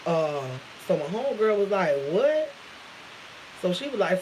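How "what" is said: high-pitched, disbelieving what; incredulous reaction. Retold or imitated. Homegirl reacting to the gossip in a text, incredulous. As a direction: jump up in pitch, imitating disbelief.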